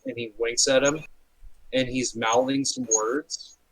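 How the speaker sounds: normal talking voice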